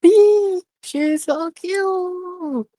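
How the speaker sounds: very high, slow squeal; gushing. A narrator's reading. Camilla gushing over Nero in a very high drawn-out squeal. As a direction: melt over something adorable.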